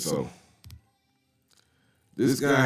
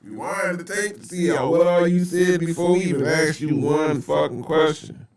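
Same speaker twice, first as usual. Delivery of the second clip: mock detectives; 7 semitones higher than usual. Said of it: "they like".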